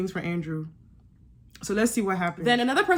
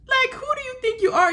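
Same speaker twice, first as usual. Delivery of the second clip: much higher, outraged disbelief; incredulous; 14 semitones higher than usual. Real, not acted. Vlogger repeating it to Davide, high incredulous. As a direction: push the question up high, can't believe him.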